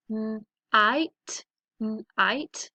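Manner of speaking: "night" split as a held n, then "ite"; segmented phonics "night"; sounded out. Shown as a demonstration. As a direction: hum the n apart from "ite". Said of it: "makes that I".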